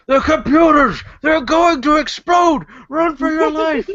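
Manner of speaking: very high-pitched frantic warning yell; panicked shout. Acted. Player's character bluffing guards about exploding computers, panicked shouting. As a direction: scream it like a hammed-up false alarm. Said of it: "I immediately shout out".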